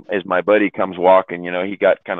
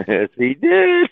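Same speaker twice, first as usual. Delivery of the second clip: high, drawn-out words through laughter; laughing confirmation; slower than usual. Real, not acted. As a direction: confirm it while cracking up. Laughing along at crude joke.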